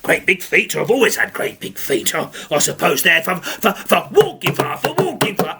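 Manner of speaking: low, muttering offended ogre; grumbling ogre. Acted. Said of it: "he says".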